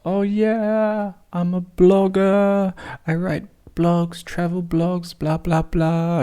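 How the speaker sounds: high, slow innocent blogger voice; prepped cover story. Retold or imitated. Giving the police a rehearsed cover story, high and slow. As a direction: act the harmless traveller. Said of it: "I have my lines prepped".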